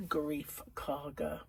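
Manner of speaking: slow whisper; hushed aside; whispered name. Real, not acted. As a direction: breathe the strange name slowly. Odd name he talks funny.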